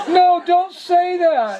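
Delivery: high, slow, whiny protest; like a little kid. Retold or imitated. Old priest protesting no sweets, whining like a kid. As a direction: whine it like a child told no. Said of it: "Like a little kid".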